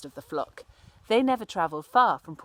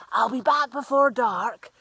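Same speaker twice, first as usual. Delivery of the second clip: louder, higher call to parents far off; 4 semitones higher, 8 dB louder than usual. Acted. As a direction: shout it back over your shoulder. Cyril calls to his parents.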